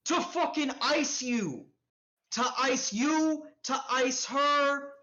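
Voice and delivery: high, drawn-out, emphatic explanation; exasperated answer. Acted. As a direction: spell out the obvious threat, stretching the ends. Stranger answers what the dude wanted.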